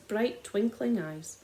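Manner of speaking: ordinary delivery, nothing marked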